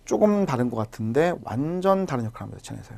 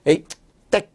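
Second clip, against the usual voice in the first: playful scolding exclamation; mock telling-off as a memory trick; mock cursing; 5 dB louder than usual. Shown as a demonstration. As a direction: toss out a pretend curse, short and punchy. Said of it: "여러분 이렇게 욕하자".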